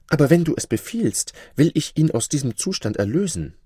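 high-pitched woman's voice; obliging sorceress. Acted. Sorceress promising her lover to free the prince, high obliging voice. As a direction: offer obedience in a high, eager-to-please voice.

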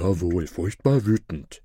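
lightly voiced henchman echo; mild comic robber voice; robbers in chorus. A narrator's reading. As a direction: parrot the chief's words. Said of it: "erwiderten die Räuber wie aus einem Munde".

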